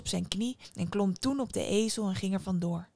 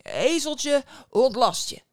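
loud, commanding call; punchy incantation in two bursts; 5 semitones higher, 8 dB louder, slower than usual. A narrator's reading. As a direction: cry the magic words at the donkey.

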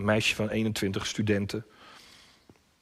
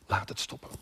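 soft, unvoiced whisper; hushed plea; 6 dB quieter than usual. Preacher praying along with Jesus, hushed. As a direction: breathe the prayer out softly. Leading quiet prayer with congregation.

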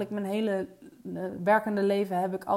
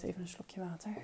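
quick whispered aside; whispering; 3 semitones lower, 16 dB quieter, faster than usual. Real, not acted. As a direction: whisper it under your breath. Aside before taking a sip.

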